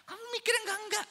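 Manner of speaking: fast, high-pitched scolding; exasperated mother. Retold or imitated. Mother tempted to scold her son, exasperated. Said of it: "tergoda untuk langsung ngomong begitu".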